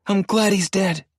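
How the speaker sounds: high and loud, through gritted teeth; bitter. A narrator's reading. Wife tells husband she's glad he's dead, bitter. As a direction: spit it out with bitter satisfaction.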